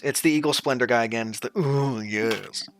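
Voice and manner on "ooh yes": ridiculous elf voice. Acted. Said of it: "the elf's voice is fucking ridiculous".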